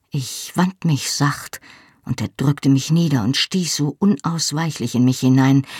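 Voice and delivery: ordinary delivery, nothing marked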